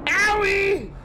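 loud high shouted yelp; pained yelp shout. Real, not acted. Gamer hit again, shouts a high yelp.

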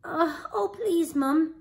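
put-on high-pitched begging boy; pleading cry. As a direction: plead anxiously as young Jack. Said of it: "cried Jack".

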